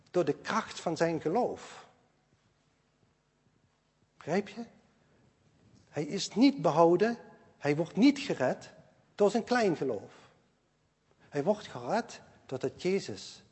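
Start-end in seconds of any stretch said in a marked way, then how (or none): none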